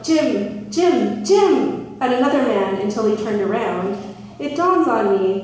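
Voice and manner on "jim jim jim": rising shouts of a name; calling out. Retold or imitated. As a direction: call the name louder each time. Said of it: "hearing a man shout".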